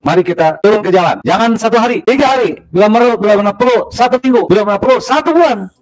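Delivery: high fast rally call; fiery revolutionary speech. Real, not acted. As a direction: urge the crowd into the streets. Clip of protest call.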